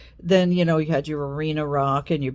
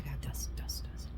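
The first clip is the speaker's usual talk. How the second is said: mother's whisper; 11 dB quieter, much faster than usual. Retold or imitated. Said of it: "she was like".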